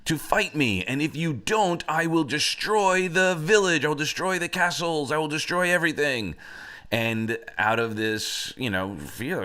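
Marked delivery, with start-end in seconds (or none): 0.0-6.4 high, booming storybook giant challenge; hammed-up giant's ultimatum; threatening giant. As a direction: bellow a threat at the village, raised pitch. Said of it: "the giant would come down there and he would say".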